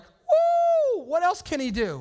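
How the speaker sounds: a high joyful whoop, then a lively question; excited disciples. Retold or imitated. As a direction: cheer like a disciple who just saw the resurrection. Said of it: "they were like".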